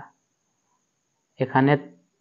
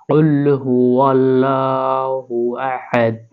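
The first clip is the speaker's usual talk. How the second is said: drawn-out tajweed recitation; slow recitation; much slower than usual. Shown as a demonstration. Teacher reciting the first verse after spelling it. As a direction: recite the verse slowly, every letter clear.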